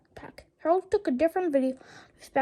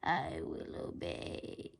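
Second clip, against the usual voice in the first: very low, soft, slow zombie mumble; mumbled; 11 dB quieter, much slower than usual. A narrator's reading. Hypnotized principal answering George, low slow mumble. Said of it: "mumbled Mister Cripp".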